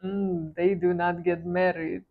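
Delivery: put-on stern father in a Russian accent; slow, heavy Russian accent. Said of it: "That was my Russian accent".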